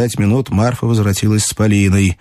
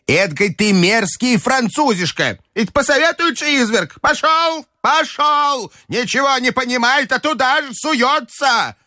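put-on very high, indignant old-lady tirade; angry grandmother. A narrator's reading. Grandmother berating the Frenchman after losing, high and angry. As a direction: rail at him in a high, put-on old woman's voice. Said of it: "крикнула бабушка, обращаясь к де Грие".

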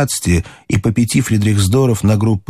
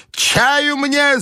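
very high imperious demand; grandmother's cry. A narrator's reading. Grandmother demanding tea on arriving home, high cry. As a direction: cry out the order in a high old-lady voice. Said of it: "закричала бабушка".

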